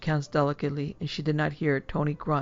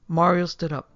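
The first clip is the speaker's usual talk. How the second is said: ordinary delivery, nothing marked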